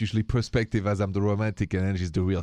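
ordinary talk

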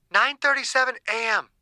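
clinical woman. A narrator's reading. Scientist logging time.

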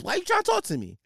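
high-pitched, put-upon complaint; exasperated protest. Acted. Mock protest at a chatty manager.

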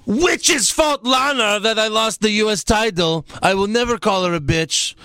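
high shouted blame; Rusev shouting. Retold or imitated. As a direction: yell the blame at her. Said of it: "Rusev says".

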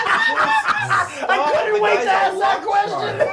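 laughing high through the words. Real, not acted. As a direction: giggle while you talk. Laughing after cheeky question.

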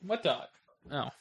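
normal talking voice

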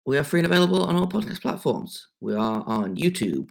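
ordinary talk, nothing marked

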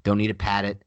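ordinary talk, nothing marked

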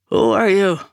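put-on gruff, rough-hewn old priest calling out; salty rough voice. A narrator's reading. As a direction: call out roughly from behind a door. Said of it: "salty and rough-hewn like the church itself".

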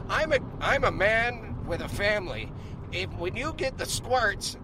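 imitating the actor. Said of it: "he's like".

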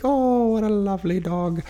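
put-on high-pitched little old Irish lady; badly faked Irish, sing-song and high; attempted Irish accent. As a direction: imitate an Irish granny cooing, high and lilting. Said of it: "little old wrinkly lady".

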